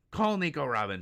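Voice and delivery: high, loud mock villain order; shouted demand. Acting out villain demanding he call her.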